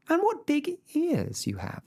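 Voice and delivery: put-on high, bright little girl answer. A narrator's reading. Girl answering the wolf, high and eager. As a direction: chirp it like a small girl.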